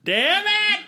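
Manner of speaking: very high-pitched "damn it"; comically high exclamation; mock frustrated echo. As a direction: squeal it in pretend exasperation. Echoes the mock outburst.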